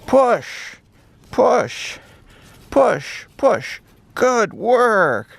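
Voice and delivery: high puppy voice, pushing with effort; high-voiced pup, mock strain; little dog straining. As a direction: squeak out the effort of shoving something heavy. Dog chanting while pushing snow.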